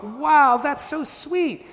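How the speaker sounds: high, slow, gushing parent reaction; mock delighted. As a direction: overdo the sweet delight for the kid. Dad reacting to son's card.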